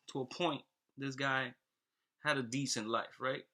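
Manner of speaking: normal talking voice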